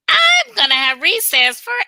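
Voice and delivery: put-on high, loud childish campaign shout; kid running for class president. Mocking the president as a grade-school candidate, shouting high. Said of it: "an elementary school president race".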